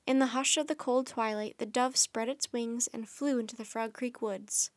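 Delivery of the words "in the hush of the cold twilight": plain reading voice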